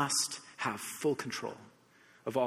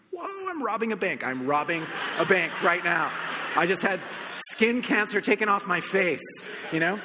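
high, louder rush of disbelief; amazed excitement; 4 semitones higher, 3 dB louder than usual. Retold or imitated. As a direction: marvel as if you got away with a robbery. Amazed it is free.

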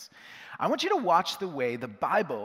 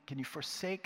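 ordinary delivery

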